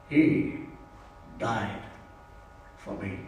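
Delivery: very low and soft, word by word; solemn epitaph. Retold or imitated. Congregation confessing on Good Friday, solemn and hushed. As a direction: drop to a hush, pausing after each word.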